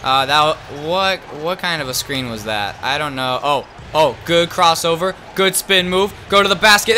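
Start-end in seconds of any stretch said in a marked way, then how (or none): none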